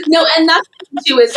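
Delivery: high, helpless laughter through the words; real laughing. Real, not acted. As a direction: repeat it cracking up, pitch climbing. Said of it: "sorry, I'm still laughing".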